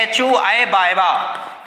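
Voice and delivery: एच ओ आई बायबा said very slowly, each sound apart; slow rote chant of the formula; slow recited formula. Shown as a demonstration. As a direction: recite एच ओ आई बायबा syllable by syllable for the class. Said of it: "सूत्र होता है वो क्या".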